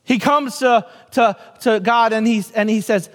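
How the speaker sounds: usual speaking voice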